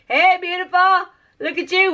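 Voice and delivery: high excited coo. Real, not acted. Greeting the paint.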